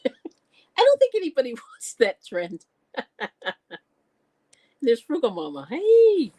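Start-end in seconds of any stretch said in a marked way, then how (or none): 5.7-6.3 bright hello. Real, not acted. Greeting a viewer by name.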